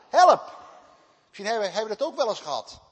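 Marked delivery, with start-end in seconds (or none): 0.1-0.6 loud, high, urgent cry of "Help". Acted. A man in need crying out for help, loud desperate call. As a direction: shout "Help" like a drowning man.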